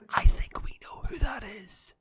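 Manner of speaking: conspiratorial whisper. Acted. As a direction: whisper it as a knowing aside. Knowing aside about a chat troll.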